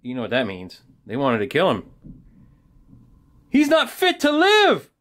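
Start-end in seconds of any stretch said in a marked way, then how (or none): 3.5-4.9 very high, strained crowd cry; mock angry yell. Angry crowd wanting Paul killed, high mock yell. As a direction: play an outraged mob, pitched way up. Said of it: "they started to yell".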